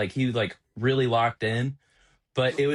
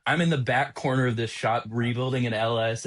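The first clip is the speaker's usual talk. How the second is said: ordinary talk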